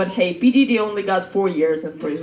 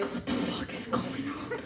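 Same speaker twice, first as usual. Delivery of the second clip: whispered reaction; 17 dB quieter than usual. Retold or imitated.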